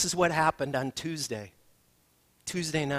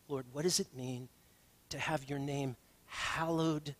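prayer; 2 semitones lower, 5 dB quieter than usual. Acted. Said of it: "leads prayer".

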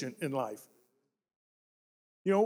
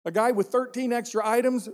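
ordinary talk

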